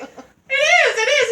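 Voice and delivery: high, loud squeal through laughter; excited agreement. Retold or imitated. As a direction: cry out in laughing, reenacted agreement. Retelling her excited agreement, laughing.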